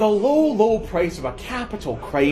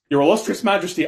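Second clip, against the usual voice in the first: ordinary talk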